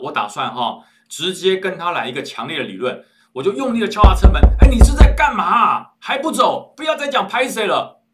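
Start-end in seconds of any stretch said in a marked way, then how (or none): 4.6-8.0 loud, high, furious yell at the stranger; furious shout. Retold or imitated. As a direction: bang on the door and bellow. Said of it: "其实这时候我已经火冒三丈". (火冒三丈地冲着车里的人大吼)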